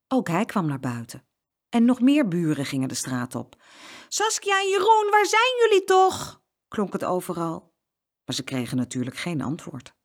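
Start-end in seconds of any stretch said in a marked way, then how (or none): 4.1-6.4 searching call. A narrator's reading. Neighbours searching for the missing twins, calling out. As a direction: call out so the whole street hears. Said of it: "riepen ze".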